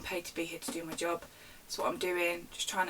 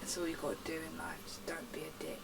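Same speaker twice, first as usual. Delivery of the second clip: soft unvoiced whisper of the moral; hushed final aside; 4 semitones lower, 10 dB quieter than usual. Real, not acted. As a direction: drop to a whisper for the punchline. Closing line of rant.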